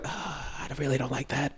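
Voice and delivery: soft, sighed-out retold reluctance; quiet, uneasy quoted aside; hesitant mock objection. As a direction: imitate someone timidly protesting under their breath.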